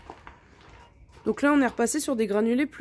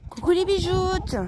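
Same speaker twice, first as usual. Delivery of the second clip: petite voix attendrie pour les poules; voix aiguë affectueuse; 10 semitones higher, 3 dB louder, slower than usual. Real, not acted. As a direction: parler aux poules comme à des bébés. Greets her hens in pet voice. (voix de gazouillis affectueux)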